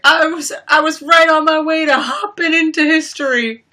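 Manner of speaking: high, loud goofy boast; imitating Jackson. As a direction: brag like the clumsy brother. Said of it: "And then he was like".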